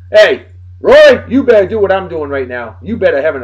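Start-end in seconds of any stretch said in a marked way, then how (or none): none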